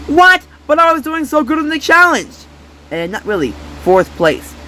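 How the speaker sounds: ordinary delivery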